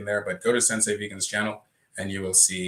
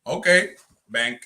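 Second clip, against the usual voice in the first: careless rich spender; 8 semitones higher, 8 dB louder, slower than usual. Retold or imitated. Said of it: "people out there who's just like".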